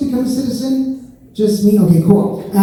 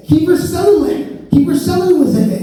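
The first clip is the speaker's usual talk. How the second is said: eager insisting; 7 semitones higher than usual. Retold or imitated. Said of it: "pleading to examiner".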